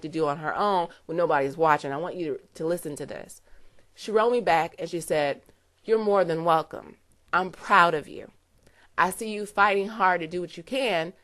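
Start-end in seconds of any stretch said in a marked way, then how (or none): none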